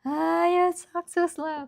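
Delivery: lightly voiced northern teaser mocking her; mocking imitation. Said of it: "they make fun of me".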